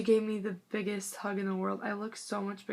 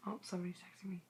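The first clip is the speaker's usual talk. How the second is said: whisper; 12 dB quieter, much faster than usual. Real, not acted. Aside about incoming text.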